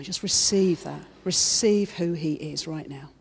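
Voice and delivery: ordinary talk, nothing marked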